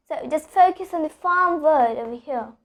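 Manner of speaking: ordinary talk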